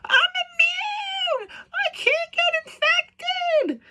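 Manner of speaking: high, slow, crying mock whine; mock her as a sobbing brat; mocking whiny Ellie. Critic mocking Ellie's crying outburst, high sobbing whine. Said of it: "her running around going".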